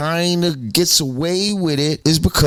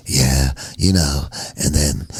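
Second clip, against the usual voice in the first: lowered, unhurried, mock polite [name]; [name]'s real voice; 11 semitones lower than usual. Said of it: "the way he really talks is like".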